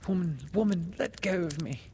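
high, pleading inner voice; inner cry. A narrator's reading. Something inside her was crying to the woman, high and pleading. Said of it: "Something inside her was crying".

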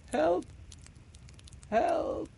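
high, thin cry for help; a weak call from the rock; feeble cry. Acted. Stranded boy and girl crying weakly for help. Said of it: "a feeble cry".

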